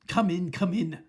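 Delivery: loud, impatient command; snapped. Acted. Witch ordering the hesitant witches in, loud and impatient. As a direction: snap them inside. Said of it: "snapped the Grand High Witch".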